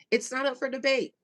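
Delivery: ordinary delivery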